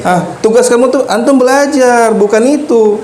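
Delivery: mock scolding teacher.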